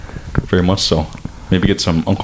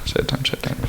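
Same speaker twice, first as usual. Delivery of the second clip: whisper; 6 semitones higher, 10 dB quieter than usual. Pretend. Mock mourning.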